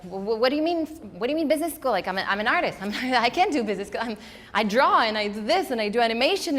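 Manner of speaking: high, loud panicked protest; freaking out. Retold or imitated. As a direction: blurt it in alarmed disbelief. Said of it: "my first reaction was freaking out".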